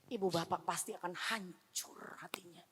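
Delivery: soft, heartbroken whisper. Acted. Pastor telling parents of heartbreak, whispered.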